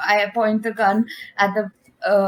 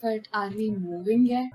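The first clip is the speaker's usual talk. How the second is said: soft, small-voiced nagging question; subdued; 8 dB quieter than usual. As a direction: ask it quietly after being told off. Same question after screaming, now subdued.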